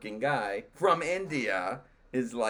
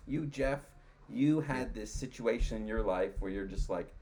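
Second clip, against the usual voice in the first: ordinary talk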